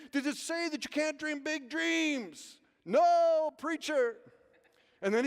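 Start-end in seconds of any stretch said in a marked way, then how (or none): 0.1-2.6 high, slow preacher cadence; imitated preacher. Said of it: "He said". 2.8-4.2 loud, high, drawn-out crowd answer; congregation's response. Retold or imitated. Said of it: "And they went".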